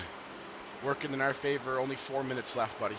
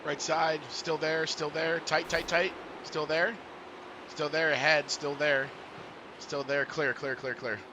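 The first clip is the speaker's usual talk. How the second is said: quick raised spotter calls; race spotter radio; 4 semitones higher, slower than usual. Real, not acted. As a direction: call the traffic urgently over radio. Spotter calling traffic on radio.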